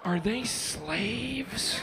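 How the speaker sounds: very slow, hushed dread; slow horrified thought. Retold or imitated. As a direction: let the question crawl out in horror. Inner guilt thoughts.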